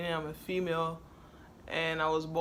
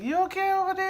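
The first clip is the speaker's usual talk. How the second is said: high, louder mock concerned call; wife imitated; 8 dB louder, faster than usual. Wife calling to her crying partner, mock concerned higher voice. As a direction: call across the room, pretending worry. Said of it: "She be like".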